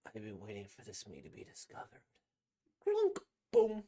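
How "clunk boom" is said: sound effect. Acted.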